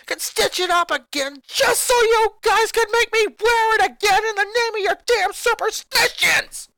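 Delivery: put-on high, loud outraged boy yelling; boy ranting at his whole family; furious rant. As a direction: let it all out, yelling. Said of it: "colorful language Lincoln used in his rant".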